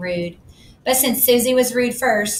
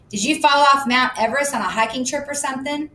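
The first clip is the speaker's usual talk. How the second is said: mocking; 6 semitones higher than usual. A narrator's reading. Susie mocking.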